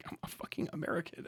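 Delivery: unvoiced mock denial; whisper. Streamer denying his bad accents, whispered aside. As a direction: whisper it as a sly excuse. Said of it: "who does that?".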